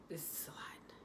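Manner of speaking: unvoiced whisper, overwhelmed. Mom overwhelmed watching her son under the lamp, whispering. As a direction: breathe it out as a whisper. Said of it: "I'm just like".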